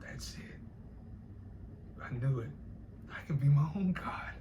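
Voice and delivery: breath-only, hushed imitation of his words; imitated meditative murmur. Replaying how the meditating man said it, whispered imitation. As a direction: whisper it like a private revelation. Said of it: "the way he said it, he was just like".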